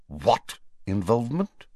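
stunned query; appalled. A narrator's reading. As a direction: ask it in disbelief, rising. Prime Minister stopped short, appalled.

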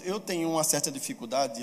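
usual speaking voice

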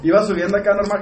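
ordinary talk

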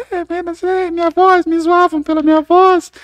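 very high, thin nervous voice; squeaky high voice. Retold or imitated. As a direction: imitate a teased kid squeaking. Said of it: "ele fala".

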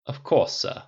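lightly voiced formal butler; a hint of a dutiful servant. A narrator's reading. Jarvis the AI obeying his master, butler voice.

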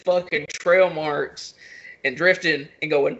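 ordinary delivery

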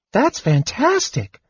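very high, delighted kender voice; wide-eyed wonder. A narrator's reading. Kender seeing sword in stone cleric, wide-eyed wonder. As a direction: marvel in a high little voice. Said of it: "the wide-eyed kender said".

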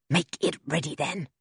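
usual speaking voice

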